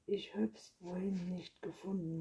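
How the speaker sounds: ordinary delivery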